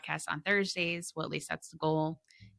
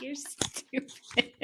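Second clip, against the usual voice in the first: teasing whisper; 8 semitones higher, 5 dB quieter, much slower than usual. Real, not acted. Teasing friend after joke.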